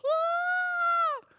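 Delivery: piercing high shriek of a screaming fan; imitating a crowd fan losing it. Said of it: "people are just like".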